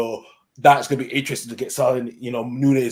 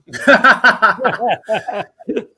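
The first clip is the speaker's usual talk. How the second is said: loud rising laugh; 9 semitones higher, 7 dB louder than usual. Real, not acted. Laughs after poltergeist joke.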